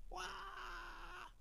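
high, faint mock child's wail; tantrum wail. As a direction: imitate a toddler's tantrum cry, kept small. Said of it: "sehingga terus jadinya tantrum". (tiruan rengekan anak tantrum)

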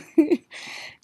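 high giggle; real high-pitched chuckle; laughing. Real, not acted. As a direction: let the last of the giggles out. Laughing fit winds down.

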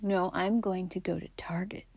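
whispered, defiant little retort; stubborn whisper. Retold or imitated. Wife stubbornly refusing her husband's advice, in a whisper. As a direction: hiss it back like a stubborn kid.

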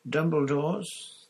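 high, slow, questioning; a dazed old man asking; confused Crouch. A narrator's reading.

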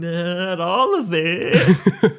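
slow, laughing guilty confession; mock guilty answer. Suspect joking to the officer, slow and laughing. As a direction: drag it out through a laugh. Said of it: "joking reply as the suspect".

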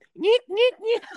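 high squeaky dolphin noise; dolphin squeak. Retold or imitated. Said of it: "kayak suara lumba-lumba".

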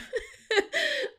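short high laugh; quick high giggle. Real, not acted. Laughing at the scene.

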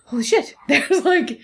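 gasp, then a laughing "holy shit"; retelling her own delight at the sprout; mock amazement. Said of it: "I look at it and go".

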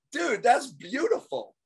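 high and slow, mock delighted. Salesman praising the homeowner's no, mock delighted. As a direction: savor the no like a gift.

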